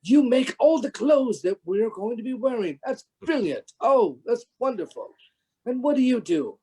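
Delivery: high, wonder-struck young boy impression; Warwick Davis. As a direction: gush like an amazed, polite kid. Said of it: "you know, wonderment of".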